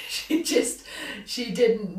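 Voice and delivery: laughing as she speaks. Real, not acted. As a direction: burst out laughing. Laughing at the story.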